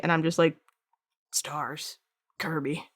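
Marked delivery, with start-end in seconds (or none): 1.3-2.9 much softer, deadpan and bored; mumbling reply to curious fans; flat deadpan mumble. Retold or imitated. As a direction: shrug it off in a low, tired voice. Imitating a deadpan artist.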